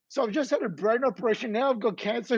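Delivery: ordinary talk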